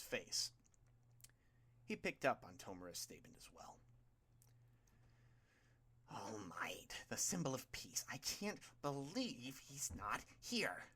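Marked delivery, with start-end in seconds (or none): 6.1-10.9 slow hushed whisper, barely voiced; Tomura whispering. A narrator's reading. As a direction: let the disappointment slip out in a whisper. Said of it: "Tomura said".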